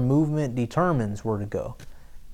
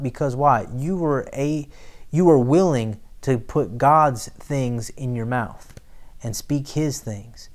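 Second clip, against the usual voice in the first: ordinary talk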